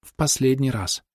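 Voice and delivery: woman tells Humphrey it is the last time, slow and wistful; lingering farewell. A narrator's reading. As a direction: let the words linger as a goodbye. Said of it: "her farewell words, then echoed".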